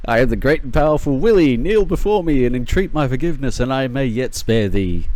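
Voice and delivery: loud, pompous mock-royal decree; lordly grandeur. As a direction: proclaim like a self-crowned king. Grandiose self-proclamation to enemy.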